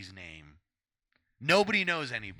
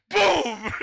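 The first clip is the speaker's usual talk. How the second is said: imitated gunshot; 11 dB louder than usual. Retelling a gunshot.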